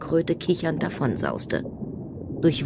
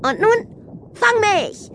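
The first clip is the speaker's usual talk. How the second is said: put-on very high, louder girlish shout; playful dare; 4 dB louder, slower than usual. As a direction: challenge them to a chase, gleefully. Mermaid dares turtle to chase. (übermütig, hohe Mädchenstimme)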